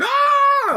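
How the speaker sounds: a very high shouted screech; mock screech. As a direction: let out a shrill dying shriek. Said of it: "the dead are not screeching".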